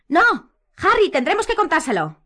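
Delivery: begging Harry; Hermione pleading. A narrator's reading. Hermione pleading with Harry, high and loud.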